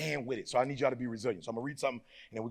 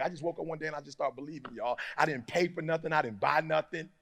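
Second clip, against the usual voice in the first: ordinary delivery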